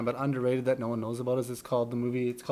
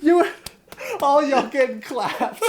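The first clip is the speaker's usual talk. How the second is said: laughing quote; 10 semitones higher, 5 dB louder, slower than usual. Retold or imitated. Quoting Kevin Hart, laughing.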